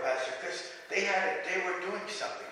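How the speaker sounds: ordinary delivery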